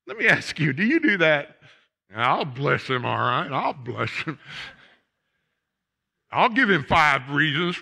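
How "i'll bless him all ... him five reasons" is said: grudging "I'll bless him" turning into a jab; sarcastic grudging blessing. Retold or imitated. Preacher mocks a churchgoer's grudging blessing, sarcastic.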